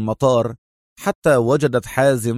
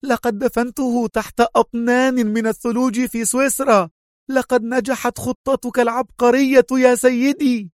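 high, proud exclamation; lieutenant boasting to her chief; proud happy cry; 12 semitones higher than usual. A narrator's reading. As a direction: call out full of pride and joy. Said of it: "صاحت في فخر وسعادة".

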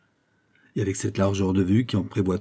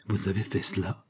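soft, stunned murmur; 7 dB quieter than usual. A narrator's reading. Fouquet crushed by Aramis's confession, murmuring. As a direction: barely voice it, much softer. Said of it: "murmura-t-il".

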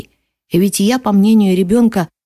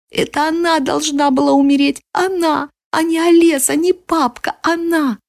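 put-on high, pained child's cry; desperate yelling boy; 6 semitones higher than usual. A narrator's reading. Boy yells again at the narrator, desperate. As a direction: cry out high, full of despair, without real volume. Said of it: "снова заорал".

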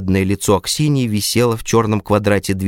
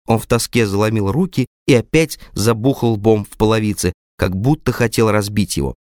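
plain reading voice

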